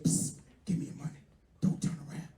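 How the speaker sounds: hushed robber whisper; whispered threat. Retold or imitated. Street robber whispering a stick-up, hushed.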